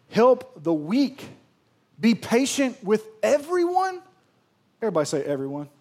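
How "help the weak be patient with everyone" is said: higher, louder and slow; rising preaching. Real, not acted. Pastor urging the church to be patient, rising preach. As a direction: build the call with force.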